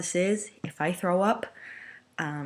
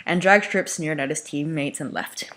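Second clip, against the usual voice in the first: ordinary delivery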